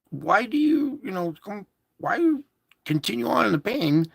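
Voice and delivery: high, incredulous doctor voice; mock-baffled doctor asking why; quoting the doctor. Doctor asking him why he keeps going, high and baffled.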